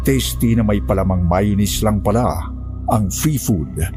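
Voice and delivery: ordinary delivery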